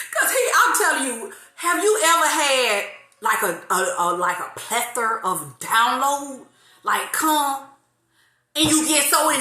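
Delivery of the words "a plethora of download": usual speaking voice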